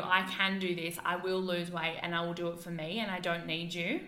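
ordinary talk

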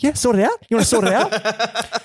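high, mock taunting challenge; hammed-up mock glare at the guitarist; mock angry taunting. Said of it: "giving him just death daggers".

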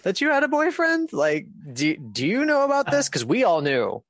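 mock-astonished friends, voice leaping way up; incredulous friends. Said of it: "they all just sort of looked at me like".

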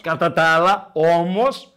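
talking over the interruption to finish his point. Real, not acted. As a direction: lean on it to take the floor back. Repeats louder after being cut off.

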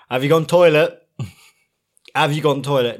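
put-on loud parent call, checking on the kids. Dad asking the kids before leaving, loud call. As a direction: call it across the house like a dad. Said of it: "in that accent".

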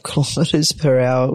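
ordinary talk

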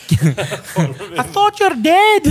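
very high, loud, drawn-out wail; hammed-up accented shriek through laughter; mock shocked cry. Shouting acted catchphrases in accent, laughing.

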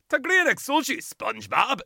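high, loud cartoon character voice; over-the-top TV cartoon impression; cartoon dub voice. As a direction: mimic a squeaky Irish-dubbed cartoon. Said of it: "this just brings me back to being like".

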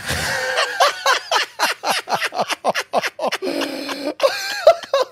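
howling laugh at his own story; big laugh. Real, not acted.

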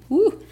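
high, loud "woo!"; short burst of spirited excitement; excited whoop. Real, not acted. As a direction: let out a real, joyful whoop. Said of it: "just know I'm getting super excited".

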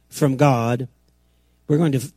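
usual speaking voice

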